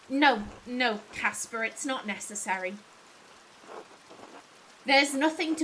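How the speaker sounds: lightly voiced weary master, trailing low; subdued, sinking at the ends; weary [name]. Master admitting his memory fails to his assistant, subdued.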